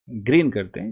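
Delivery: ordinary talk, nothing marked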